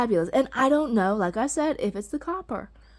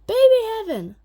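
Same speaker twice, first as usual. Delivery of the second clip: delighted echo; 12 semitones higher, 8 dB louder than usual. Real, not acted. Repeats with more delight.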